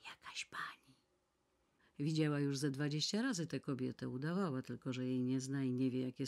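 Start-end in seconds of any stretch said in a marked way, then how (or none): 0.0-1.0 breathy voiceless whisper, very soft. Acted. Whispered under the breath. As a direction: maid whispering an announcement to her mistress. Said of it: "szepnęła".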